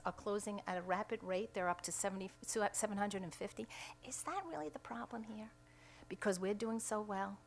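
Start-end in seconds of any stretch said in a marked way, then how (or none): 4.0-5.5 breathy stage whisper, pitched a little higher. Real, not acted. Speaker at a press conference whispers the question to the audience, mock-conspiratorial. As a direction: drop to a sly whisper as if sharing a secret.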